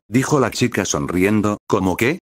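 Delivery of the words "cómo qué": mildly puzzled question, lifting at the end; confused. A narrator's reading. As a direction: ask as if not following. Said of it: "dijo confundido Krilin". (confundido, con leve extrañeza)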